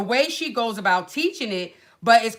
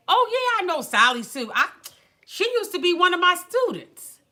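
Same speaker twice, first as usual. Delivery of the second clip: light mock of the instructor, pitched higher; a hint of the instructor's bragging voice, up high; imitating the instructor; 8 semitones higher than usual. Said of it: "this is how she talks".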